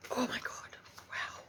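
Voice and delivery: hushed, whispered awe after a gasp; overwhelmed whisper. Real, not acted. Tarot reader overcome by spirit's love, whispering in awe.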